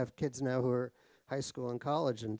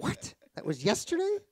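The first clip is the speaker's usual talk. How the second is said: mock confused surprise; 5 dB louder than usual. Guest mocking audience expecting yesterday's talk, higher and louder. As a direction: jump way up in pitch and louder, playing baffled.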